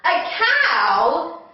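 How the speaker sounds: ordinary talk, nothing marked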